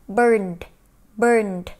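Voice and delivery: burned with the ed said as t; Vietnamese: burned đọc đuôi ed là âm t. Shown as a demonstration. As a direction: end burned on a crisp t. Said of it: "mình đọc là gì".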